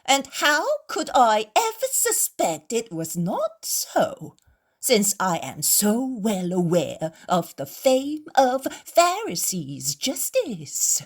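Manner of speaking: loud, slow, sarcastic drawl; mocking imitation. Innkeeper mocks the Pharisees, imitating their voices. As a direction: ham up their pious words to mock them. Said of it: "The host imitates their voices".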